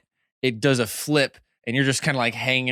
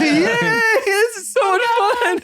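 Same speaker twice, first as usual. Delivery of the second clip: high squealing mock glee; mock cheer; slower than usual. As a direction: squeal with fake delight.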